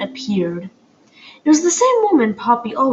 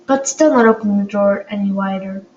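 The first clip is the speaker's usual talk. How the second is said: plain reading voice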